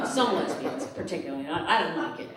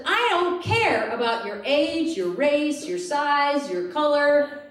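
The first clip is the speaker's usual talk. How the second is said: slow, high, loud ad quote; mock ad voice; quoting the ad; 5 semitones higher, 4 dB louder, much slower than usual. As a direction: lay out the list flatly. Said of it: "The next part says".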